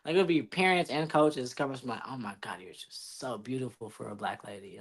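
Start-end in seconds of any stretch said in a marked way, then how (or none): 2.1-4.8 lower, softer mock gushing parent. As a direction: imitate the condescending gush. Mocking parents' fake praise.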